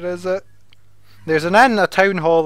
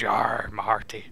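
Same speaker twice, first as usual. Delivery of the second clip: mock pirate; 7 semitones lower, 9 dB quieter than usual. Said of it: "What else the fucking pirates say".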